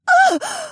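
a sudden high gasp of waking; sharp gasp. Acted. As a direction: gasp awake with a jolt. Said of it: "Shay suddenly gasped".

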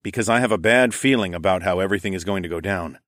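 plain reading voice

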